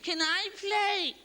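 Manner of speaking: put-on high, slow whiny kid; little brother begging to join the game, high and drawn out. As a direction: drag it out like a pleading little brother. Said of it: "when you're growing up".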